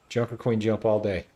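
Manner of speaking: ordinary talk, nothing marked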